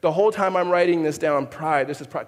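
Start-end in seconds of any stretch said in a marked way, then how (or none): none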